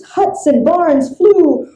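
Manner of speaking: ordinary delivery, nothing marked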